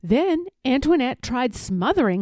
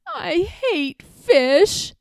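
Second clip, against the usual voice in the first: put-on sulky child; 7 semitones higher, much slower than usual. A narrator's reading. Child refusing fish.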